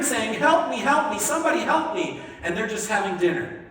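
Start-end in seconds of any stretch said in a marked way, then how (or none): 0.4-2.2 higher pleading call for help, half-acted; imitating a boy calling from the well; mock cry for help. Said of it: "They can hear him screaming for help".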